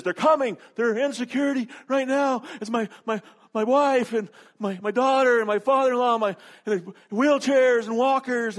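high, loud mock-panicked pleading; frantic begging. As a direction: plead desperately, stumbling over the family list. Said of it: "he's just begging for them to wait".

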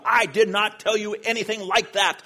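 loud, high scolding retort; angry scolding. Retold or imitated. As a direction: lay into the student forcefully, imitating the principal. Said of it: "started to just lay into me".

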